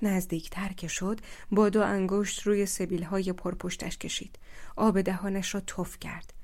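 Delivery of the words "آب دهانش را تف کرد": ordinary delivery, nothing marked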